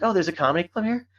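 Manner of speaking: high, bright mock surprise at the news; surprised women. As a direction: play the baffled stranger at the bar.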